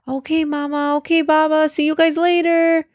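put-on high, bright little kid voice; cheerful child voice. A narrator's reading. Kids answering their parents as they run off, high cheerful child voice. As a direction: chirp it back like a happy child.

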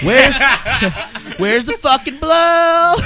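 high, loud, slow cartoon stoner; hammy stoner. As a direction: stretch it out like a wasted guy. Mocking a high hookah smoker.

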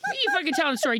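usual speaking voice

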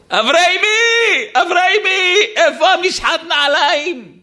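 put-on hollering across the house for the boy; retold yell. Guest shouting to the host's son for shoe polish, loud and high. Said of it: "שמתי צעקה לבן שלו".

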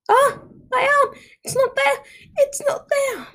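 very high-pitched panicked shriek; screaming. A narrator's reading. Marco finding his arm gone, screaming. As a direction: shriek in horror, pitch right up. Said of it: "Marco screamed soon after".

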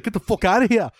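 high-pitched mock Liverpool accent; bad Scouse, drifting towards Scottish. Liverpool fan throwing out a rival, mock angry Liverpool accent. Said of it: "like, a guy from Liverpool".

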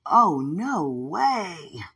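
drawn-out, very slow, loud no way; emphatic no. Retold or imitated. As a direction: stretch the refusal out big. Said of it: "They were like".

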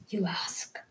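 soft whispered aside; whispering. Real, not acted. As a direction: whisper it to the viewers. Playful echo of own words.

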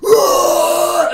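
huge, high, loud beast roar; gorilla roar. Acted. Gorilla roars to scare off the forest creatures. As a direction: roar like a giant ape. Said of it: "and roars".

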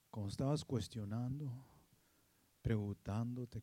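low, soft, partly whispered reassurance; intimate whisper. Acted. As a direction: speak close to one heart. God speaking to listener intimately. (susurro íntimo)

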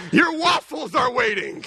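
slow, high shouted wake-up call; mock yell. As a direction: shout it at the kids to wake them. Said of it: "kind of like a Lazarus".

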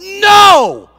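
high, loud yelled no; sudden sideline yell of protest. Retold or imitated. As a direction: blast it out in the heat of the moment. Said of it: "I yelled".

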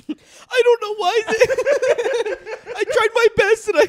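high, laughing fake weeping; mock sobbing contestant. Mocking a weeping contestant after her pork is criticized, high laughing sob. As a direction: sob it out in a squeaky parody of a crying cook. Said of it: "she's like".

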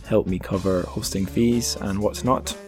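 ordinary delivery

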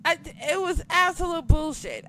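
normal talking voice

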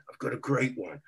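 usual speaking voice

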